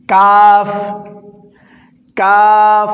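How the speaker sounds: কাফ pronounced loud, tongue further forward on the palate; Arabic letter kaf. Shown as a demonstration. Teacher demonstrating kaf to adult learners, loudly. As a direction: hit কাফ cleanly off the palate. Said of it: "জিহ্বার আগা থেকে একটু আগে".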